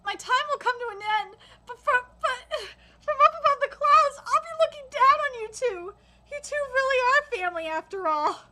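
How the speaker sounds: choked-up reading, halting at the start; fighting back tears. Real, not acted. Reader reading mother's farewell, trying not to cry. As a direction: read on while holding back tears. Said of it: "I'm trying not to cry".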